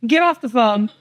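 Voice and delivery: high-pitched firm scolding, half laughing; no-nonsense mom. Retold or imitated. Mom ordering her daughter off a FaceTime call, high exasperated scold. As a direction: tell her to hang up, exasperated but amused.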